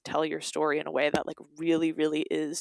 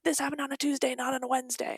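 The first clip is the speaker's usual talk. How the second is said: high, prim nitpicking voice; high mock pedant correcting a date; pedantic nitpicker; 8 semitones higher, faster than usual. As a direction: mimic a fussy stickler, pitched up. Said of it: "will be like".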